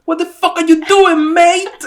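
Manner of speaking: put-on British accent. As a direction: bark it like an angry British cyclist. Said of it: "[name]'s impression of a British accent".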